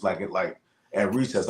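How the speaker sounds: normal talking voice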